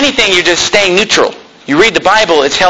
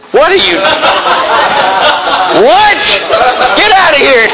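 mock outrage; 4 semitones higher than usual. Mock outrage at kids' show of hands.

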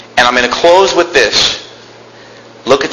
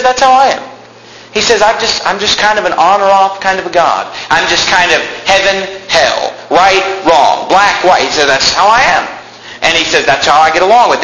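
rising, insistent sermon delivery; fervent preaching; 7 semitones higher than usual. Acted. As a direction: preach it as Jesus calling them in. Preaching God's nature.

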